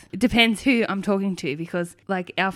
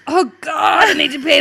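high, loud, desperate exclamation; retold panic; 7 semitones higher, 7 dB louder than usual. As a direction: act out a sudden urgent panic. Retells sudden desperate need to pee.

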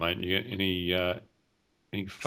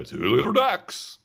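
loud, high, drawn-out call; bingo call; 10 semitones higher, 8 dB louder than usual. Acted. Co-host showing his reflex to twenty-two, bingo call. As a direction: sing out the number like a bingo caller. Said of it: "I just go".

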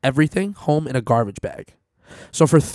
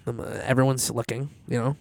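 ordinary talk

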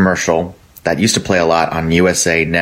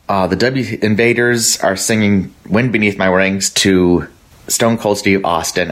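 ordinary delivery

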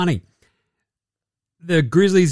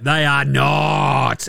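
slowly, each word weighed; slow emphatic no; 3 semitones lower, much slower than usual. Real, not acted. Podcaster to listeners, slow emphatic denial. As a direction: drag out the verdict.

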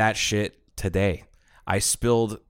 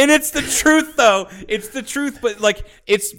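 laughing; 14 semitones higher, 5 dB louder, slower than usual. Real, not acted. Teasing through laughter, high.

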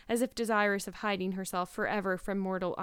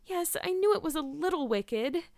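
put-on higher, girlish admission; admitting; 8 semitones higher than usual. A narrator's reading. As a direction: own up to a small sin. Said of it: "admitted Anne".

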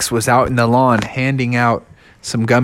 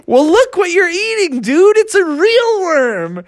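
high Bubby voice. Acted.

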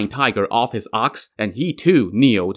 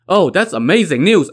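higher-pitched, lightly comic delight; delighted blurt; 7 semitones higher, 3 dB louder than usual. A narrator's reading. Court officials hear the princes escaped, delighted blurt. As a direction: let the glee slip out in a lifted voice.

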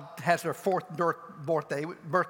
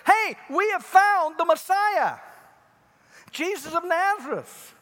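much higher, louder, slow exclaim; excited news; 10 semitones higher, 6 dB louder than usual. Retold or imitated. Andrew telling brother Peter, excited shout. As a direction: burst out with the great news. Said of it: "tells his brother Peter".

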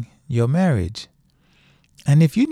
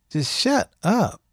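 a lightly voiced, higher sneering brush-off; a hint of a dismissive friend; mock dismissive; 7 semitones higher than usual. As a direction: toss it off like a careless adviser. Mocking people who silence grief.

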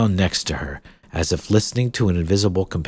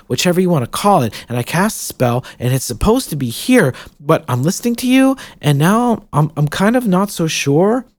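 put-on higher, rambling girl voice; nervous young girl; 10 semitones higher, 5 dB louder than usual. Jill rambles nervously about ghost.

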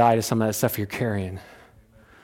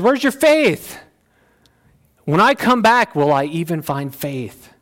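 stern rebuke; 5 semitones higher, 3 dB louder, slower than usual. Retold or imitated. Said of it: "He was saying that very stern".

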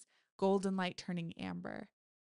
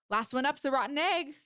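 loud, high playful call; excited cry; 7 semitones higher, 6 dB louder than usual. Acted. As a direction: call it out racing ahead. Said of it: "Abby cried".

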